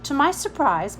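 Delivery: usual speaking voice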